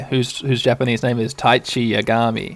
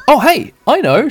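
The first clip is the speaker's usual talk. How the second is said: higher, louder eager idea; bright idea; 11 semitones higher, 5 dB louder than usual. Acted. Sora struck by an idea, high and eager. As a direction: pop up with a plan. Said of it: "she says".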